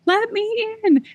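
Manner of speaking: high, drawn-out mock cat wail; pleading cat wailing at the door, held high; imitated screaming cat. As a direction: stretch the words into a long, high yowl. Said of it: "screaming bloody murder".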